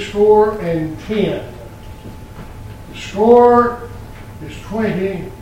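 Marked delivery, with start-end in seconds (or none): none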